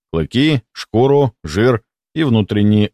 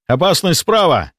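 warning shout; 8 semitones higher than usual. A narrator's reading. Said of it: "предостерегающе закричал я".

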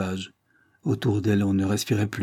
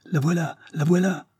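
higher, eager announcing cry; excited shout; 8 semitones higher than usual. A narrator's reading. Bigorne bursts into the house, calling out excitedly. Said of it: "cria la Bigorne".

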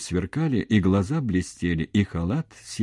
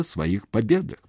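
usual speaking voice

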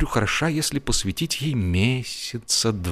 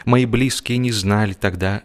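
usual speaking voice

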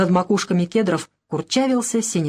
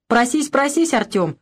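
egging on; 5 semitones higher than usual. A narrator's reading. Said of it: "подзадорил внука Захар".

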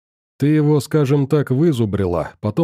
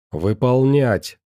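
barked order; slower than usual. A narrator's reading. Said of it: "рявкает Ария".

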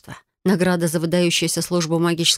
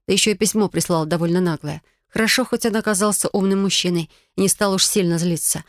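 usual speaking voice

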